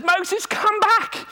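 high, slow drawn-out call; God calling out. Retold or imitated. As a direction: call him back from afar, slowly. God calls Moses back.

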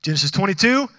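usual speaking voice

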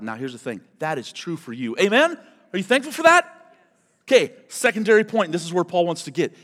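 ordinary talk

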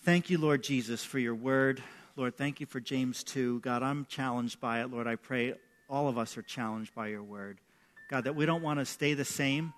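hushed, reverent prayer to God; quiet closing prayer. Acted. Pastor leading the congregation in prayer, hushed and reverent. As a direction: pray softly and earnestly with the room.

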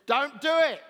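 loud, high shouted command; shouting. Acted. Preacher voicing the Bible's warning to the church, shouting. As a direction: shout it out as an urgent order. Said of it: "Sorry, am I shouting?".

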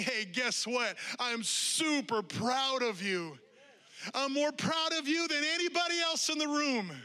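loud, slow, beaming pride; proud, exuberant praise. Acted. Jesus with an arm over your shoulder, loud and proud. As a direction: burst with pride over them.

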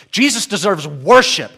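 "worship" punched hard at the end; stress on "worship". Shown as a demonstration. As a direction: build to a forceful "worship". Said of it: "every word is emphasized".

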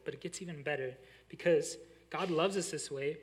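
normal talking voice

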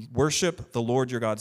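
ordinary delivery

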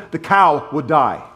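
ordinary delivery

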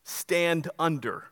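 slow teaching emphasis on stand under; slow deliberate split. Shown as a demonstration. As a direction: stand under said very slowly, both words apart. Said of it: "just flip it around".

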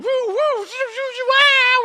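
high whooping ride screams, loudest at the end; thrilled whoops. Retold or imitated. Riders whooping as the coaster takes off, high screams. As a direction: whoop like you're dropping down the coaster. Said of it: "We're like".

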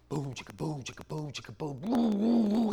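imitated drum beat, rising toward the end; beatbox the noise the devil likes; playful mock beatbox.

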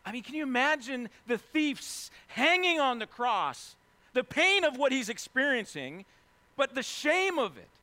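loud, high impassioned sermon; passionate preaching. Real, not acted. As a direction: press the thief's despair home with full force. Preaching at full force.

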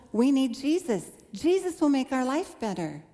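bright hopeful promise, lightly retold; young convert's excitement. New believer telling her parents, mildly excited. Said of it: "I was so excited".

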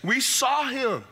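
high, slow, insistent telling; pressing the news on a doubter; insistent disciples. Retold or imitated. Disciples urging Thomas, high and insistent. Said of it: "They kept telling him".

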